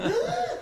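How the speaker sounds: sharp high gasp of amazement. Retold or imitated. Wizard reacts to the spell, gasping. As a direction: gasp like you've seen a miracle.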